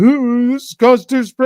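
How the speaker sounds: high, loud whiny mock yell; mock scream. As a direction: squeal it like an outraged crank. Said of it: "They love to scream".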